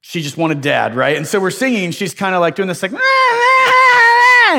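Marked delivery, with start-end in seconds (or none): 2.9-4.6 put-on high, loud baby wail, ah ah ah; imitated baby yelling. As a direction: mimic a one-year-old crying out for dad. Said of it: "this awkward yelling and crying".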